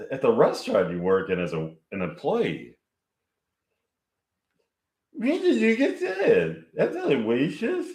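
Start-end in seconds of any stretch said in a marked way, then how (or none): 5.1-7.9 much higher, cheerful put-on waitress voice; Maud's voice. Maud answers the couple, high cheerful character voice. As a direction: sing it out high through puffed cheeks. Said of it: "Okay, this is Maud's voice".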